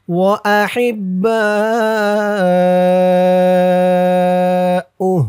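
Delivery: baa held very long; slow chant with a sustained level baa; four-alif stretch. Shown as a demonstration. Teacher reciting for learners, baa stretched to four alifs. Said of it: "বা এখানে চার আলিফ টান হবে".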